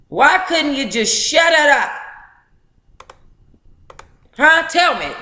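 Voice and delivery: high, slow, incredulous demand; exasperated rant. Real, not acted. Streamer venting at the man in the book, exasperated. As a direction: throw the question at the room, fed up. Ranting at the husband's behaviour.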